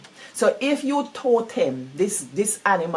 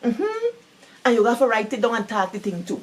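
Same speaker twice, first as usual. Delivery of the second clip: ordinary talk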